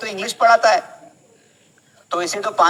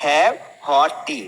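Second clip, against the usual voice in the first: each word laid out slowly for the class; slow model phrase; 4 semitones lower, much slower than usual. Shown as a demonstration. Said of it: "क्या बोलेंगे?".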